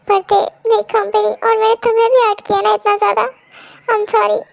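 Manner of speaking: choking out sorry again; tearful. Acted. Husband still crying, apologizing to wife. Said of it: "रोइए मत".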